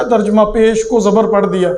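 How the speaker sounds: ordinary talk, nothing marked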